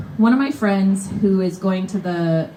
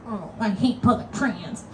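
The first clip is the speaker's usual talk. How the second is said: pouting; 8 dB quieter than usual. Retold or imitated.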